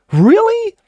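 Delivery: loud, high-pitched sarcastic disbelief; mock surprise. Host reacting to a quoted statement, mock surprise. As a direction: feign shock, leaping up high.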